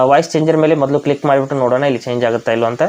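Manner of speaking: ordinary talk, nothing marked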